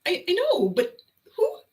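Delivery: high, half-laughing disbelief; laughing quote. Retold or imitated. Imitating the shopper laughing at the staple counting, high and giggly. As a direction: laugh through the words as you quote him. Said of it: "He's laughing".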